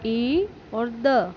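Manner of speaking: slow letter-by-letter spelling; teacher spelling it out; spelled out slowly. Shown as a demonstration. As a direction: sound out each letter slowly. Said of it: "और दूसरी".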